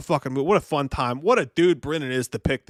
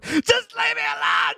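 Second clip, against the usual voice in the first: much higher, slow, shouted tantrum; sulky teen shouting at his mom; whiny teen shout; slower than usual. Retold or imitated. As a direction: yell it like a sulking teenager. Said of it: "And he goes".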